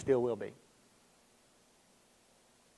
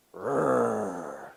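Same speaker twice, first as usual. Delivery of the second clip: low, soft rolled growl; little growl; 9 semitones lower, 9 dB quieter than usual. Retold or imitated. Buddy trying to get the lion's attention, low mock growl. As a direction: imitate a small growl, drawn out. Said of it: "make his little growl".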